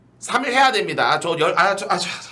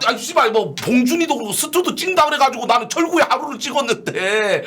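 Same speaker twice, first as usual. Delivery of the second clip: angry ranting; 8 semitones higher than usual. Real, not acted. Ranting angrily about shoot.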